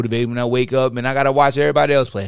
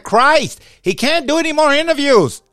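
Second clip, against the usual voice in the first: exasperated coach; 12 semitones higher, 5 dB louder than usual. Retold or imitated. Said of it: "Coach would be like".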